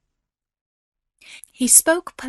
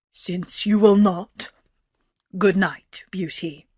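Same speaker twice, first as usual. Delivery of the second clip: slow, heavy beast farewell; gruff beast; 2 semitones lower, 3 dB louder than usual. A narrator's reading. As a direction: bid good night as the beast, dropping at the end.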